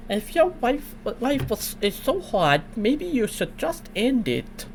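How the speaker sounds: high, slow Elmer Fudd-like voice; lightly voiced cartoonish old man.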